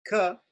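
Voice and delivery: "Ka" crisp with no puff; unaspirated ka. Shown as a demonstration. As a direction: hold back the breath. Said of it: "There's a difference between".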